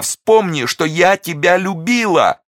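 very high, loud, slow desperate plea; pleading cry. A narrator's reading. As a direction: beg him to remember her love. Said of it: "вскричала она". (отчаянная мольба о любви)

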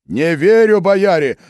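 high, loud cry of disbelief; shout. A narrator's reading. Philip to the boyars, shouting in disbelief. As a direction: cry out against the confession. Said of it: "крикнул Филипп".

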